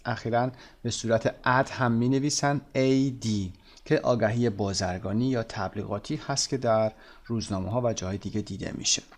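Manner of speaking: ordinary delivery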